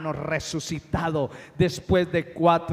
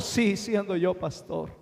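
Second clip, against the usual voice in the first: ordinary delivery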